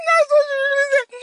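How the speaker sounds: cracking up in a high squeal; laughing high. Real, not acted. Telling viewers about grandma, laughing high. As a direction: laugh the words out, barely holding it. Laughing at her own story.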